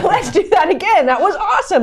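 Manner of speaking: high, laughing enthusiasm; imitated thrilled investor. Investor thrilled after a first deal, high mock excitement. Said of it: "and then they're like".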